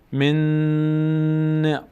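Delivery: "من نع" with both Ns merged into one long hum; merged nasal noon. Shown as a demonstration. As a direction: hold the joined N of "من نع" in the nose.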